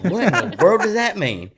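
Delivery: high, loud, laughing disbelief; boss baffled. Retold or imitated. Retelling his country boss's baffled reply, laughing. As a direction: blurt it out amazed. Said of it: "He said".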